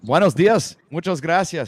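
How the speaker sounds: high, loud playful Spanish greeting; hammed-up Spanish showing off; goofy loud Spanish. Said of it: "I know you're having a lot of fun with that".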